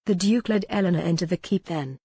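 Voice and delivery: ordinary delivery, nothing marked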